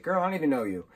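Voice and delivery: sassy dismissive. Retold or imitated. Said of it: "he's like".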